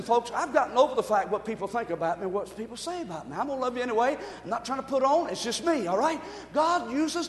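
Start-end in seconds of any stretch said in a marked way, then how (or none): none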